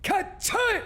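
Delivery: very high, put-on ka-ching; mock cash register. Joking about green numbers with a high mock ka-ching. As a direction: ring it out like a till.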